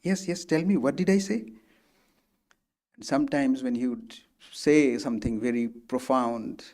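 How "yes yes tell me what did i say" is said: eager retelling of his 'yes, yes, tell me', pitched higher; eager interest. Retold or imitated. M retells how the master begged to hear his words: eagerly interested. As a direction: play the teacher hungry to hear his own words back. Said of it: "very interested".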